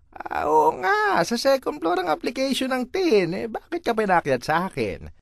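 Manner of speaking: imitating the officer who sent him back; mocking officer. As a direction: mimic the clerk's fake surprise. Quoting officer condescendingly.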